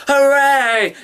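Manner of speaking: very high, loud hooray; cheer. Real, not acted. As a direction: cheer like your team just won. Cheering the winner.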